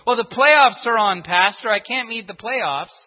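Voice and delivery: hammed-up plea to the pastor; mock loud excuse. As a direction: churchgoer telling pastor he must watch playoffs, mock loud.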